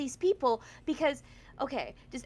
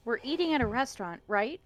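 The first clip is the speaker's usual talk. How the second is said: ordinary talk, nothing marked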